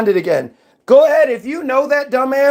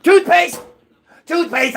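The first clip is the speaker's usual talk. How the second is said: high, yelled nonsense answer with a cry in it; mocking a clueless person yelling toothpaste; 7 semitones higher than usual. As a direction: yell it like a panicked idiot. Mock dim answer to dinner question.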